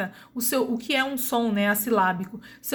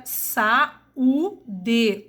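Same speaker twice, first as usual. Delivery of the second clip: saúde drawn out, the ú stretched; teacher stretching saúde; slowed demo word. Shown as a demonstration. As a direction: slowly lay out saúde for students. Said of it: "o U também aqui não é semivogal".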